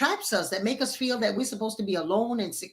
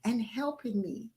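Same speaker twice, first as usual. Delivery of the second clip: high, softer and sugary, a false promise; sweet-talking lion; 4 semitones higher, 7 dB quieter than usual. Acted. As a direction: coax him with a high, soft voice.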